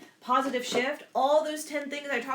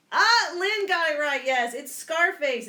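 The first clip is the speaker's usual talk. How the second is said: much higher and louder, slowed right down with delight; excited cheer; 5 semitones higher, 8 dB louder, slower than usual. Real, not acted. Teacher cheering a student's right answer, excited and loud.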